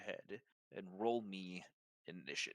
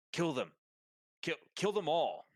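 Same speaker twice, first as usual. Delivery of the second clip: raised, higher commanding cry; urgent order to kill, louder and higher; shouted order; 6 semitones higher, 5 dB louder than usual. Acted. Villain ordering guards to execute prisoners, shouted command.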